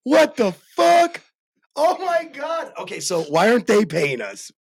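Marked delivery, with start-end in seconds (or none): 0.0-2.7 very high, stretched-out amazement; astounded. Real, not acted. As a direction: squeal it slowly in total disbelief. Amazed by news of salt gun.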